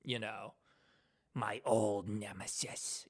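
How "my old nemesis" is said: low, soft, very slow character voice; Helpy voice. Shown as a demonstration. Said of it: "doing the Helpy voice".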